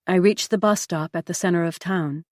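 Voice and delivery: usual speaking voice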